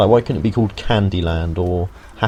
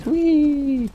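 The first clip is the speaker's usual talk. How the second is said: long, very high-pitched "wheee"; playful whee. Acted. Player miming the jump down to viewers, playful high whee. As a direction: squeal a sustained high whee. Mimes jumping down the drop.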